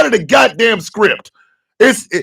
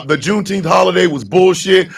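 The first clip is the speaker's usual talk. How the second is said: usual speaking voice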